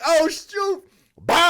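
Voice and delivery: high-pitched retold alarm; startled cry. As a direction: yelp as the shot comes. Reliving getting shot at.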